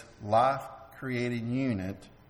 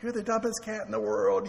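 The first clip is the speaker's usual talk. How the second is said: nice loving voice; 8 semitones higher than usual. Shown as a demonstration. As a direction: insult said in a higher, sweet pet-talk voice. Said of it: "in a nice, loving voice".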